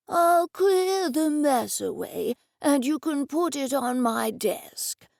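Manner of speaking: put-on slow, shaky old man voice; the old office owner welcomes Edison, slow and quavering; elderly man. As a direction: speak like a frail old gentleman. Old Mister Dennison voiced.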